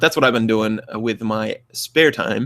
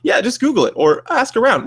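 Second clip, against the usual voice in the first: much higher, breezy brush-off; dismissive debater; 12 semitones higher, 4 dB louder than usual. Retold or imitated. Mocking a debate opponent, high breezy brush-off. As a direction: toss it off airily. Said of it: "whenever somebody says".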